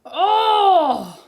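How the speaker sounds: loud, high groan of frustration; exasperated groan. Real, not acted. Player fed up with the game's counters, exasperated groan. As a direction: let out a long, high "oh" of exasperation.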